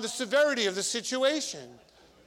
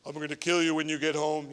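lower, fast mock parent threat; lightly voiced angry parent; parent God voice; 4 semitones lower, faster than usual. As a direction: rattle off a half-joking parent threat. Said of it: "another voice that comes out as a parent".

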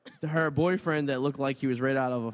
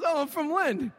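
high, bright stranger voice; guy from Lynn; 12 semitones higher, slower than usual. Retold or imitated. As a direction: pitch the stranger right up.

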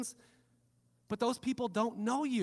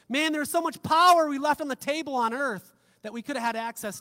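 louder, higher outburst of realization; emphatic exclamation; 5 semitones higher, 9 dB louder than usual. Acted. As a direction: exclaim it as a sudden regretful realization. Imagined regret on reaching heaven.

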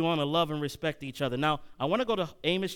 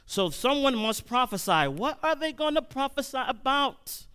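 high, raised preacher's question; impassioned preaching; 8 semitones higher than usual. Real, not acted. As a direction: ring the question out to the congregation. Preacher presses a question on congregation.

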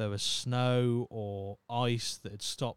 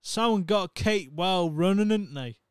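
loud, broad Norfolk "well running, ain't they"; Norfolk accent; 7 semitones higher, 9 dB louder than usual. Retold or imitated. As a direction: belt out the saying like a Norfolk local. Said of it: "Turned into".

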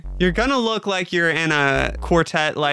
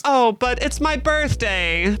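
put-on spoiled birthday girl; 8 semitones higher than usual. Rich birthday girl pleading.

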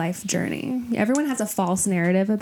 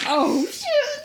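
surprised. Real, not acted.

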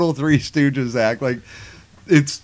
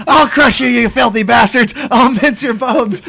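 shouted, much higher and louder; hammed-up threat; 8 semitones higher, 5 dB louder than usual. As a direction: bellow a cartoon hero's threat. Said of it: "He's like".

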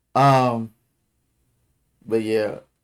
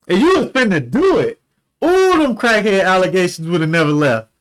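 louder higher laughing jab; loud laughing tease; 6 semitones higher, 7 dB louder than usual. Real, not acted. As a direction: roast her while cracking up. Teasing partner, laughing loudly.

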